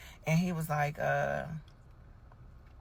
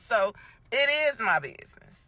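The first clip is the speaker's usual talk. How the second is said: higher and louder, putting her foot down; insistent retort; 6 semitones higher, 6 dB louder than usual. Acted. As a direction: hammer home the point to the cashier. Arguing with cashier, insisting.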